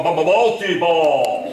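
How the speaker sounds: slow, stuttering game announcer call; pinball machine voice. Shown as a demonstration. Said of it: "voice for a pinball machine".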